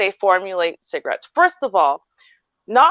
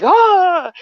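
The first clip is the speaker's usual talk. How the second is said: mock rush gasp; 10 semitones higher, 5 dB louder than usual. Head rush from a cigarette.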